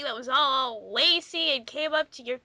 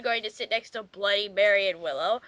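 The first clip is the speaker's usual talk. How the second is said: ordinary talk, nothing marked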